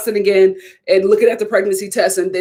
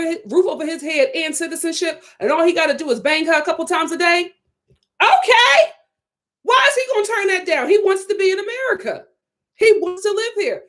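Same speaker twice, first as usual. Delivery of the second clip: heated rant; 7 semitones higher than usual. Real, not acted. Indignant rant defending Zied.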